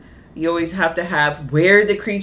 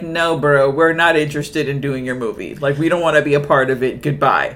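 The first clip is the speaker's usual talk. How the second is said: lightly voiced brush-off, low and fast; dismissive museum official; 6 semitones lower, faster than usual. Speaking as the museum refusing the movie, mock dismissive. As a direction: turn them down in a lowered, quick voice. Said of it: "the actual museum was like".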